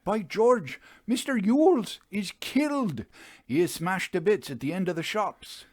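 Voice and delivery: a pale man blurting out a death; high panicked report; shocked fitter. A narrator's reading.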